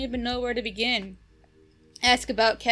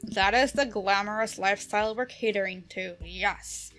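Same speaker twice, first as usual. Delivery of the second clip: normal talking voice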